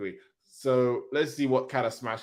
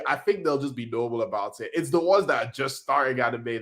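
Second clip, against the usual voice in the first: usual speaking voice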